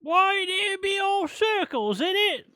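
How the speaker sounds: very high, slow British put-on voice; mock British accent. Friend joining in the adaptation spoof, in a high mock British accent. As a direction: play along with a squeaky, exaggerated British accent. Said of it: "Why am I British?".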